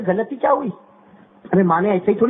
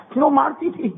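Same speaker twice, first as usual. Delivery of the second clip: usual speaking voice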